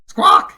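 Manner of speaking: loud, low bird squawk; griffin squawk. A narrator's reading. Griffin signalling the way, a loud low squawk. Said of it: "he cried".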